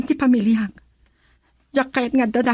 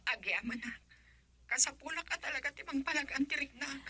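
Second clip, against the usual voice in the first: hushed; 19 dB quieter, faster than usual. Acted. Hushed plea to friend.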